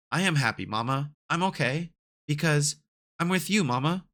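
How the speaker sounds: put-on a child comforting his mother, lifted up; lightly voiced child.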